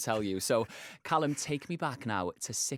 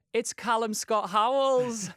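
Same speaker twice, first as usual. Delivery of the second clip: much higher and louder, drawn-out showbiz reveal; big guest announcement; 12 semitones higher, 6 dB louder, slower than usual. Acted. Radio host unveiling her guest, grand announcer reveal. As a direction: announce the star like a game-show host.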